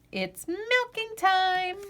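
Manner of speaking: drawn-out singsong refrain; lilting storybook refrain; slow refrain. A narrator's reading. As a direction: stretch it out like a chorus. Refrain read slowly.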